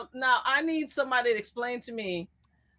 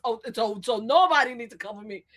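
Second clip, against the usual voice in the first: ordinary delivery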